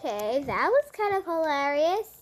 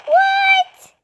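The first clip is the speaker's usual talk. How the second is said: high, loud startled what; sudden yelp of shock; surprised; 12 semitones higher, 8 dB louder than usual. Real, not acted.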